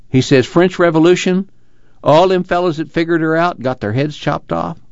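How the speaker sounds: Casey, earnest storyteller. Acted. Casey tells Tom of a jailed union man, animated. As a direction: tell it keenly, voice lifted.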